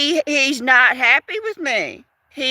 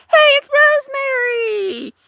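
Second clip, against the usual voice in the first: a little animal calling out hello; high cry-like pet voice; 11 semitones higher than usual. Pretend. Speaker voices pet greeting viewer.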